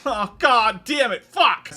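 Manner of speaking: high, slow exasperated swearing; exasperated cursing. Real, not acted. Reviewer fed up while retelling the plot, exasperated.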